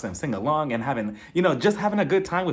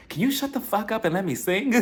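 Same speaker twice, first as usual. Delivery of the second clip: higher mock telling-off, close to laughing; annoyed singer, imitated; 7 semitones higher than usual. Radio singer tells fan to stop, mock annoyed. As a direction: lightly play a singer scolding someone. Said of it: "the singer who's singing the song tells her".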